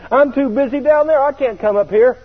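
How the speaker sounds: high plea to stay on earth; pleading protest. Retold or imitated. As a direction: protest like a kid who won't go. Repeated protest to Jesus.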